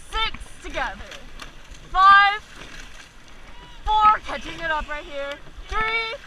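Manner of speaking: loud, high urgent calls over the boat; shouting coxswain calls. Real, not acted. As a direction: drive the crew with every count. Coxswain calling power ten.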